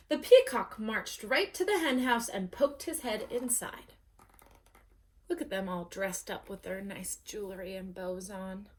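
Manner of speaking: ordinary delivery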